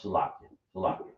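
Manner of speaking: "Salakia, Salakia" said quickly and softly; quick demo pronunciation. Shown as a demonstration. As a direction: rattle off "Salakia" twice, low and soft. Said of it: "I would have to say".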